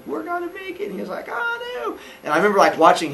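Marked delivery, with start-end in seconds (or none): 0.1-0.9 high, hushed squeal; soft falsetto little-boy squeak; hopeful kid cheer. Retold or imitated. Boy to his brother in a bonding moment, hopeful soft squeal. 1.3-1.9 tiny high falsetto, soft; squeaky little-brother voice, very high; mock kid dismay. Younger brother answering mid-run, high squeaky mock dismay.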